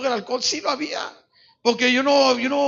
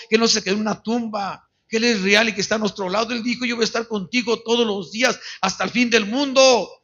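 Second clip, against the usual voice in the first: impassioned preaching, building louder to a rallying call; forceful pulpit exhortation, swelling; 5 dB louder than usual. Real, not acted. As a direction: drive the congregation toward applause.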